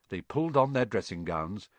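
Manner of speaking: ordinary delivery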